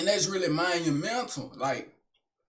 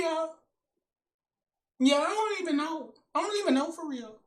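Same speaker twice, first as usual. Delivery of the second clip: put-on high girl voice; 8 semitones higher than usual.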